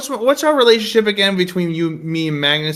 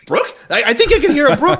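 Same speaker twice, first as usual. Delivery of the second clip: Brook voice; 9 semitones higher, 4 dB louder than usual. Retold or imitated. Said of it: "We did the voice just before".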